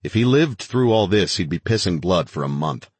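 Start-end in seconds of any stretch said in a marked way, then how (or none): none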